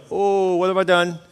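slow, high, drawn-out dismay; mock fright. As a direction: react as if caught out by God, playfully scared. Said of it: "That's a scary verse".

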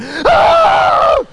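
loud, high shriek of terror; child's scream. Acted. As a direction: scream full out like a terrified kid. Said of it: "I just went".

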